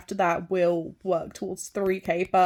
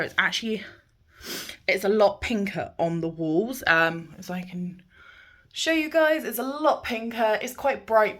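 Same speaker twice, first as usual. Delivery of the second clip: ordinary delivery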